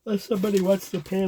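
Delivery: words stretched through a yawn. Real, not acted. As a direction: talk mid-yawn. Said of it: "I'm yawning".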